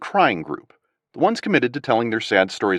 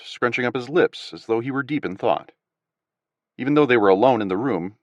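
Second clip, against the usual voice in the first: plain reading voice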